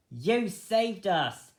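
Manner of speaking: high slow squeal of thanks. Acted. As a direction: squeal with gratitude. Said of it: "the young rabbit squealed".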